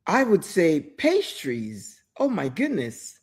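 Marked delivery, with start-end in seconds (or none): none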